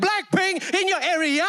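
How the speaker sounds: high-pitched fan cheer; all-in hype yell. Retold or imitated. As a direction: yell a slogan back with total abandon. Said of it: "I was the loudest".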